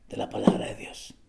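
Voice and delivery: whispered answer, unvoiced. Shown as a demonstration. Preacher answering his own question in a whisper. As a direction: breathe the answer secretly. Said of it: "¿De dónde nos vamos a alimentar?".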